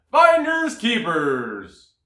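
loud, slow bear voice. A narrator's reading. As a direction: drag it out like a big bear. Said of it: "cried the bear".

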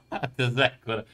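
laughing. Real, not acted. Laughing at game joke.

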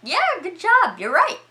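high, loud, over-bright congratulations; sudden cheery praise. Retold or imitated. Storyteller voices Howard praising the guessers, mock cheerful. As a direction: switch to exaggerated cheer, pitch way up.

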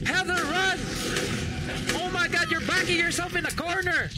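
high, loud, frantic shouting; panicked yelling. Real, not acted. Player urging Heather away from a monster, panicked yell. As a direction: yell urgently at someone in danger.

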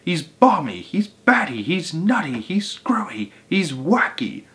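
high sing-song list of insults; crying out. A narrator's reading. Bird declares Mugglewump mad, crying out high. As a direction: rattle off each in a high pitch. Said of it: "cried the roly-poly bird".

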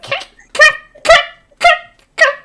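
squeaky kicking voice. Acted. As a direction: squeak each kick like a tiny monster. Imitating demons kicking.

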